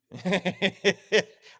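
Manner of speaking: real laugh. Real, not acted. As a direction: laugh out at your own joke. Laughs at own aside.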